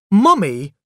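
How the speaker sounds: put-on high, loud child's call; shouted. A narrator's reading. Boy left without tea, shouting for mother. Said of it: "I shouted".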